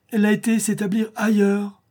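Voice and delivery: high-pitched, pained wail; sorrowful repeat. A narrator's reading. Countess laments her lover living elsewhere, sorrowful. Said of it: "répéta douloureusement la comtesse".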